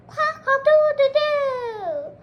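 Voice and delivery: high, loud cock-a-doodle-doo; rooster crow. Acted.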